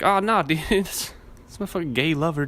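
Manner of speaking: high, loud mock-casual excuse with a laugh; smooth-talking killer. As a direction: play bluffing the cops. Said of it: "and he was like".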